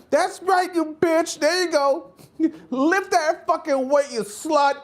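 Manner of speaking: high, brassy mock cheer; cheering gym guy. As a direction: hype her up with fond insults.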